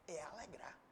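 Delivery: whispered punchline. Acted. Pastor to congregation, hushed whisper. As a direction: drop to a whisper on the point.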